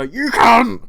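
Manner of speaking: high, loud curse through gritted teeth. Retold or imitated. Shop worker curses the rude customer in the stock room, through gritted teeth. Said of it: "grit my teeth and just go".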